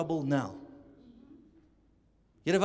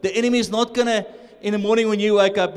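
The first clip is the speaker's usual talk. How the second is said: usual speaking voice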